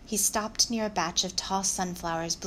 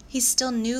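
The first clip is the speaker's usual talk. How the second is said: higher, louder protest; defensive; 5 semitones higher, 6 dB louder than usual. Acted. Louis defending the donkey against Nora, defensive. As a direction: jump to his defence, pitched up.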